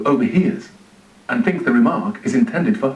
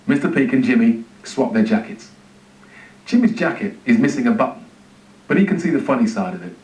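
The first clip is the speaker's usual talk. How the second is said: usual speaking voice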